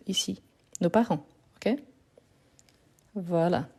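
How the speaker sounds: usual speaking voice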